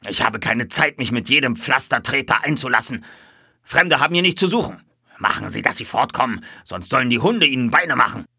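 loud, angry boss voice; gruff stable boss. A narrator's reading. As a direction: threaten the strangers off your yard.

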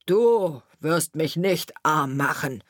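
slow, dismissive grumble; grumbling carter. A narrator's reading. Carter answering the sparrow's threat, grumbling to himself. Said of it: "brummte vor sich".

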